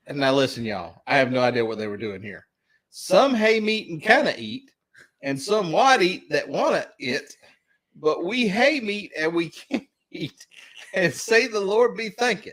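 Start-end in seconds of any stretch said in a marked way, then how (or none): 2.9-12.5 higher-pitched, measured verse reading; verse recitation. Acted. Host reading an old grace to trivia players, verse recitation. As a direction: recite each line with high, punched words.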